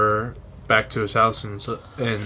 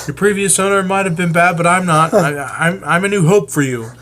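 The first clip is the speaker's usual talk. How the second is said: higher and louder, pleading and fast; earnest owner voice; 9 semitones higher, 5 dB louder, faster than usual. Acted. [name] promises bird PD he is a new hope, higher pleading voice.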